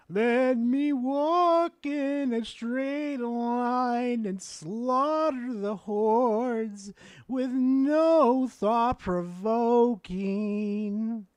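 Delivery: slow, mocking sarcasm; sarcastic drawl. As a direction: drag it out mockingly. Mocking Diablo's mindless gameplay.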